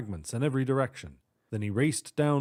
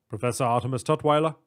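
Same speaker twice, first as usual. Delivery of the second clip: quick questioning manager; 4 dB louder, faster than usual. Acted.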